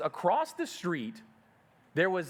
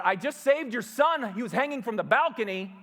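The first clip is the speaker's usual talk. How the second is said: urgent call; 7 semitones higher than usual. Retold or imitated. Telling mother he saved son.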